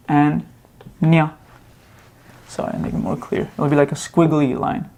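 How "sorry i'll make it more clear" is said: whispered aside. Real, not acted. Muttered aside while writing.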